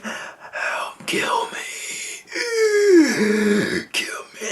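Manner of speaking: breathless whisper, begging; whispered plea. Retold or imitated. Dying colonist begging the marines, weak whisper. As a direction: beg weakly in a dying whisper. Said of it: "And she's like".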